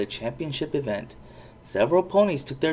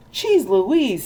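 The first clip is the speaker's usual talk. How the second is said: amazed mouse; 10 semitones higher, 6 dB louder than usual. A narrator's reading. Mouse Sam amazed at transformation.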